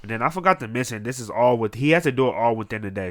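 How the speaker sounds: ordinary delivery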